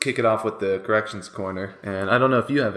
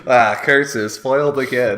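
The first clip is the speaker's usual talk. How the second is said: hammed-up loud cartoon villain; mustache twirling villain; 3 semitones higher, 8 dB louder, slower than usual. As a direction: cry out like a foiled cartoon villain. Mock villain defeat line.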